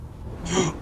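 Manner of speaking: sudden startled gasp; sharp gasp. Real, not acted. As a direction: gasp in surprise. Surprised gasp in game.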